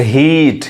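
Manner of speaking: हीट with a drawn-out ee; long vowel. Shown as a demonstration. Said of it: "तो ये long sound है".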